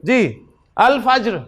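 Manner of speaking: ordinary talk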